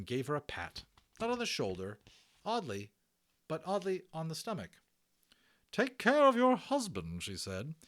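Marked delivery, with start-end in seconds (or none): 5.7-7.2 high loud brisk nurse voice; a bustling hospital matron; hearty Matron. Acted. Matron reassuring Omri about the Indian, high and hearty.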